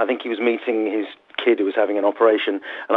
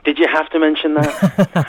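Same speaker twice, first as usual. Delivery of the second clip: mock indignation; 6 semitones higher, 4 dB louder, slower than usual. Real, not acted. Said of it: "Sorry, yes".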